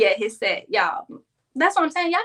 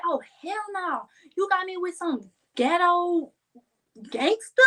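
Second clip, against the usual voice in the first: outraged disbelief; 8 semitones higher than usual. Retold or imitated. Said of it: "I said".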